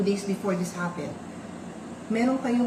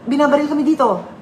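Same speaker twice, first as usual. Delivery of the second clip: urgent plea; 4 semitones higher, 10 dB louder, faster than usual. Retold or imitated. Frantic message during shooting.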